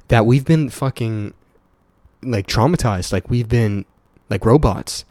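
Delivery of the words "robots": ordinary talk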